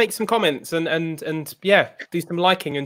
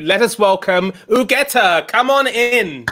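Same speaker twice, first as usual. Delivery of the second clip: higher-pitched, building call to the stage; rousing welcome; 5 semitones higher, slower than usual. Real, not acted. Host bringing the next artist on, rousing welcome. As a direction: announce the guest with rising excitement.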